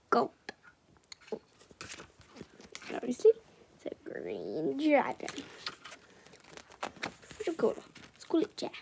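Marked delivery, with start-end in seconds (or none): none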